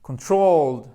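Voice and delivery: Controlled with a loud, long TROLLED; stress on second syllable. Shown as a demonstration. Teacher redoing the word for students to repeat, strongly stressed second syllable. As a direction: model "Controlled" firmly, punching the second syllable. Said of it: "on va refaire ces deux-là".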